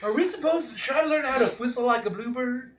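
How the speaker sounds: high-pitched, questioning character; player's character voice. Acted. Character asking friends about whistling, high voice. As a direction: pitch it high, puzzled by the task.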